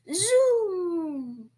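loud, high, drawn-out zoom; plane noise. A narrator's reading. Child imitates a plane.

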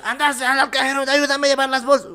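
high-pitched, urgent mum voice; imitated bossy mother. Mother sending son to the cash machine, high imitation. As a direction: order the son around as mum.

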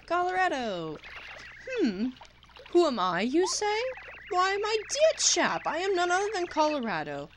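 high, pompous British adventurer voice; posh explorer. Acted. Explorer introducing himself to Mario, pompous and posh. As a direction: play the grand old chap explorer. Voicing explorer Kolorado dialogue.

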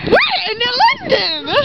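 영국 억양 흉내; British accent. Pretend. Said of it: "in, like, a British accent".

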